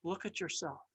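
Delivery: the potter gently handing the cup a mirror; gentle invitation. Acted. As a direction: offer it softly. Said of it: "he handed me a mirror and said".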